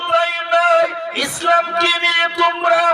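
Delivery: high, slow, drawn-out sermon chant; preaching at full force. Acted. Preacher chanting to the congregation, high and slow. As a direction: lift the voice and stretch each word to the crowd.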